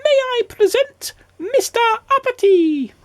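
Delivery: very high ceremonial goblin; goblin announcing. A narrator's reading. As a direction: proclaim in a tiny goblin pipe. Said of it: "announced the goblin".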